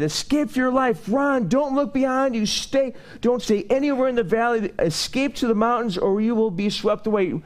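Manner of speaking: very high, pressing angel's warning; urgent warning. Acted. Angel warning Lot to flee, urgent and high. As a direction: urge him to flee for his life. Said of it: "Do you sense there's urgency in that?".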